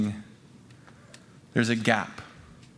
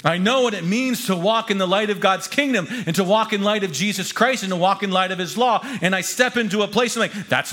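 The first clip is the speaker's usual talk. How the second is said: higher, driving declaration; impassioned preaching; 6 semitones higher, 4 dB louder than usual. Acted. Author vowing to bring God's kingdom, impassioned preaching. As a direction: proclaim it with rising conviction, easing at the end.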